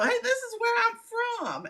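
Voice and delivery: high, perky video greeting. Pretend. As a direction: chirp it like a show host. Mock video intro as Suzanne.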